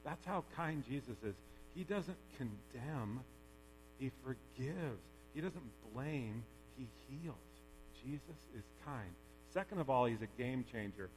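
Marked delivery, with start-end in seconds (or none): 1.7-9.1 tender. Real, not acted. Sermon point, slowed and softened.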